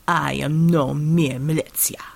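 low, slow, weighty character voice; armored hero, defiant. A narrator's reading. Supernaut defying his leader before leaping into battle, defiant. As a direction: declare it slowly and low, refusing orders. Supernaut's line in narrated story.

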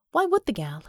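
ordinary delivery, nothing marked

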